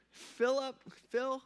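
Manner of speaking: mock-incredulous name call, pitched high. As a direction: say the name in acted disbelief. Jesus acted baffled at Philip.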